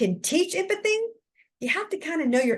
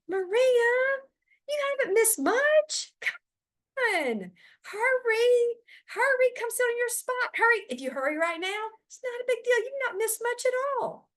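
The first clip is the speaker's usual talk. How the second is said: much higher, sing-song hurry-along voice; brushing-off teacher; 12 semitones higher than usual. Acted. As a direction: wave her feelings off and rush her in.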